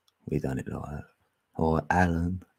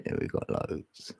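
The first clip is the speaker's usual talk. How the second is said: whisper; 9 dB quieter than usual. Real, not acted. Whispered aside after laugh.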